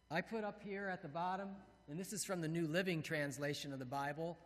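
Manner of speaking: normal talking voice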